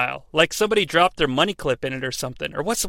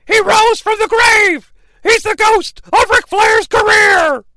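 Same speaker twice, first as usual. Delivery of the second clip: much higher, louder, slow announcer shout; Tony Schiavone; 7 dB louder, slower than usual. Retold or imitated. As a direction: bellow it like a stunned announcer. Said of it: "and I quote".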